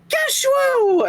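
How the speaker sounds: high, joyful squeal of a name; excited cry. Acted. Sisters greeting Geshuo with a high excited cry. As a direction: cry out his name in delight.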